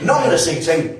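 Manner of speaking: usual speaking voice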